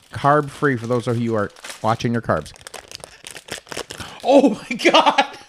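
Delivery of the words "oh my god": laughing in disgust. Real, not acted. Gagging at seaweed smell.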